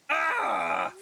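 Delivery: a loud, high, furious cry; angry cry. Acted. Grieving sibling vents rage at the killer, furious cry. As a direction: let out a raging Ah. Raging over sister's killer.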